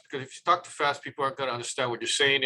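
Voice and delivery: rushed, rapid-fire delivery; zu schnell reden. Shown as a demonstration. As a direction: rattle it off far too quickly. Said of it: "do not talk too fast".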